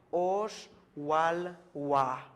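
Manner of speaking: "Osh Wal Wa" said very slowly, each word drawn out; slow modeled pronunciation. Shown as a demonstration. Teacher drilling Maya counting for students, slow and clear. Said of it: "teacher models Maya number".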